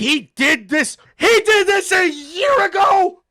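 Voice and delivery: high, loud shocked yelling; excited shout. Real, not acted. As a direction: shout it out in disbelief. Streamer shocked at clip.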